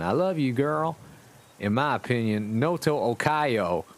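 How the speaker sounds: usual speaking voice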